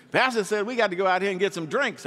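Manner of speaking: fast, high and loud mock misquote; a congregant gleefully twisting the pastor's advice, hammed-up and fast. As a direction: ham up a churchgoer twisting his words.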